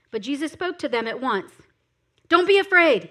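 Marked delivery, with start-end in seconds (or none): 2.3-3.0 raised reassurance. A narrator's reading. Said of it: "he said".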